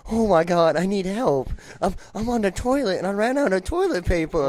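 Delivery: desperate prank caller. Retold or imitated. Friend prank-calls the front desk, mock-desperate plea. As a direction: act out a panicked prank call for laughs. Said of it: "pretend he's the room next door".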